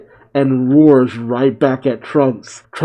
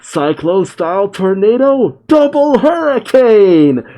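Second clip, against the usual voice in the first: mock attack yell; 7 semitones higher than usual. Goten and Trunks call their joint attack, rising mock yell. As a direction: build up to a hammed-up special-move yell. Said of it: "As they both yell out".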